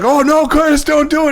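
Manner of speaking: high-pitched panicky dad, retold; freaking out. Dad to Curtis as a fight brews, mock freaking out. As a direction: imitate a worried dad pleading, pitch jumping up. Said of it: "my dad's freaking out".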